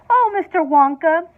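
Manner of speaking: mother wailing to Wonka for help. A narrator's reading. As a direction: plead in distress. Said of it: "wailed Missus T V".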